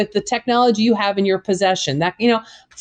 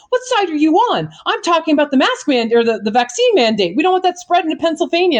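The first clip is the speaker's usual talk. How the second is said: higher, indignant mock outrage; a retold indignant woman, hammed up; incredulous retort; 7 semitones higher than usual. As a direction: snap back like she can't believe the question.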